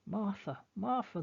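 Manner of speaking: high, ringing calls through the woods; calling out. Acted. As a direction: call her name from afar. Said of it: "the voices call".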